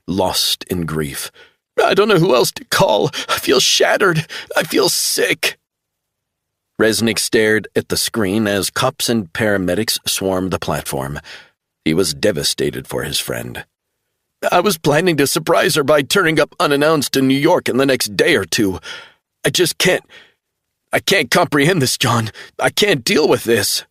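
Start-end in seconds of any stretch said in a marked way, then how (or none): 1.8-5.6 put-on much higher, sobbing like a little boy. A narrator's reading. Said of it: "began to sob like a little boy". 14.5-23.8 put-on much higher, sobbing like a little boy. A narrator's reading. Travell grieving.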